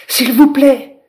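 high loud cry for help; calling out. Acted. Lila calling to the passing dragonfly, loud cry. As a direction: shout across the pond. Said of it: "cria Lila".

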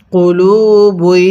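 slow, held long vowels; measured teaching recitation; model recitation. Shown as a demonstration. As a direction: stretch both long syllables for the class. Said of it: "কিভাবে পড়ব".

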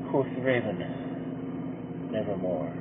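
ordinary delivery, nothing marked

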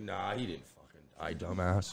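mock dismissal. Acts out dismissing the hoax.

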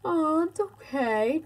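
high soothing coo. Real, not acted. Dad soothing crying child.

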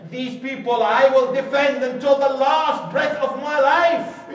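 high, loud declaration of loyalty; full-voiced oath of loyalty; loud vow. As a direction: proclaim it to the last breath.

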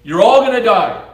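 loud, high street-preacher warning; yelling the warning at the whole city; shouted proclamation. Retold or imitated. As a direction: call it out like a doomsday prophet. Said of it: "and he's saying".

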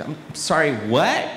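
confused American. Acted. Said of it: "speak to them in their own accent".

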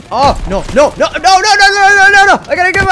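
high, loud rising panic; frantic real panic; panicked cries. Real, not acted. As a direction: plead as things go wrong. Panics as a grenade lands.

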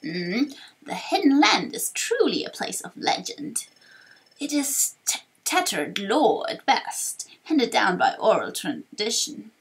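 lilting elder recounting a legend, halting on words; old storyteller with stretched, swooping words; wise old sage, musing. Acted. Torkoal tells of legend.

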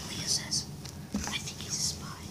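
hushed whisper. Acted. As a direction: whisper the line off to the side. Whispering lines to partner.

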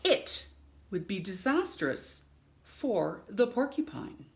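lively picture-book read-aloud with long pauses; reading to children. Acted. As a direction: read it like story time for little ones.

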